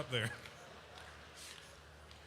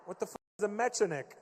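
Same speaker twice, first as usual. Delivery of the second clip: normal talking voice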